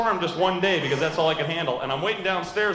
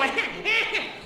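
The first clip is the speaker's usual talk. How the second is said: much higher shrill cackling laugh; mom's laugh imitated. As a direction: cackle high like the mother. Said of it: "but she laughs like this".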